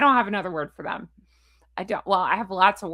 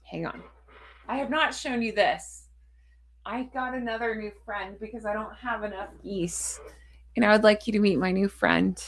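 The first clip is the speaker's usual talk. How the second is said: ordinary talk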